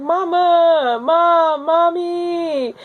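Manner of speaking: high, loud plea calling for her mother; desperate calling out. Retold or imitated. Woman in labor crying out to her mother, high and pleading. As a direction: cry out for mom mid-contraction.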